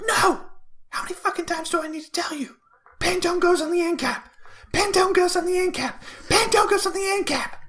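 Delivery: screaming rant in a very high, loud voice; furious screaming manager. Retold or imitated. Manager screaming at the supervisor about the aisle, hammed-up fury. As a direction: blow up at the worker over the aisle.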